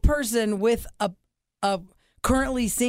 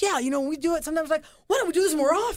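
mimicking husband; 6 semitones higher, faster than usual. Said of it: "He goes".

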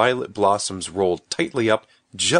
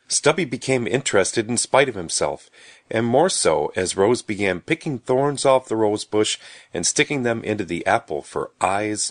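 ordinary delivery, nothing marked

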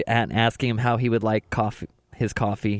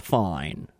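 low, soft one-word impression; imitated TV host's low "Fine"; Chris Hansen; 7 semitones lower, 5 dB quieter than usual. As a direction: drop FINE low and quiet. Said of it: "because of the way he said".

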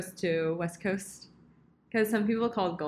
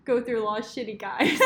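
laughing; 11 semitones higher, 8 dB louder than usual. Real, not acted. Laughs at own line.

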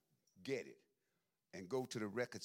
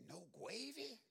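dog voice; 10 semitones higher, slower than usual. Retold or imitated. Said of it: "He would say".

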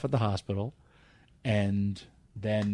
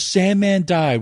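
higher and louder, blurting it out; loud retold blurt; 8 semitones higher, 8 dB louder, slower than usual. Upset wrestler blurts to the promoter that Sandman died, loud and high. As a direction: shout the bad news in a panic.

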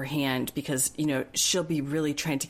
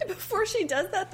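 normal talking voice

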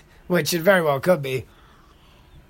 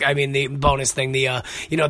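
ordinary talk, nothing marked

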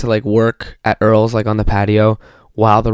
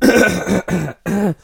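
ordinary talk

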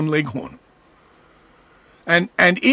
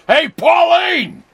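high, slow hollering shout over the fence; hammed-up neighbour shouting to his wife; 12 semitones higher, 5 dB louder, slower than usual. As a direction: holler at Pauline across the yard. Mock neighbour yelling to wife.

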